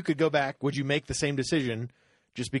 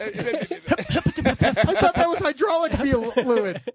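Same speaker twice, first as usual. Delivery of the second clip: much higher, slow, laughing through it; 14 semitones higher, 5 dB louder, much slower than usual. Pretend. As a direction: giggle out the words slowly. Laughing robot joke.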